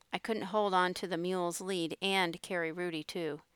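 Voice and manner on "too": usual speaking voice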